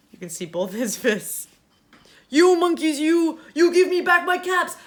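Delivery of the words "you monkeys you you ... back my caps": much louder, higher angry demand; angry peddler. Acted. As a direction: shake both fists and shout. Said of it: "This made the peddler angry".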